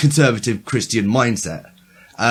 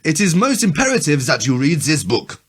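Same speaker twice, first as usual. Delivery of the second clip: put-on heavy German accent; 4 semitones higher than usual. Reading the German's warning to friends, in a heavy German accent. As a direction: deliver it like a stage German warning. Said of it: "a German with a heavy German accent".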